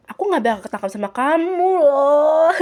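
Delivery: cheeky gloating; teasing boast. Acted. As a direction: brag playfully, lifting the pitch. Kira boasts teasingly to Laura.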